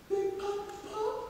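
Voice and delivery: put-on high, soft child's voice; tiny sweet boy calling out; pretty little voice. Little Zeus calling Kronos, high and soft. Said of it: "with his pretty little voice".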